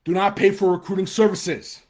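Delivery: real annoyance breaking through; angry shout. Real, not acted. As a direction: bark the warning out. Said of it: "it pisses me off".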